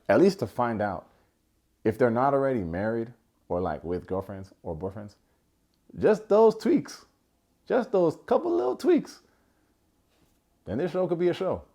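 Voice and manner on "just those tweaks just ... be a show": wistful sing-song. Acted. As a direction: float it up like a wish. Dreamy wish for show fixes.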